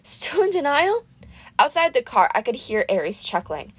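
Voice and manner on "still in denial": airy goddess teasing, pitched up; slow lilting mockery from the goddess; Aphrodite, high and slow. A narrator's reading. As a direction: coo it slowly like a vain goddess. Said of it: "Aphrodite said".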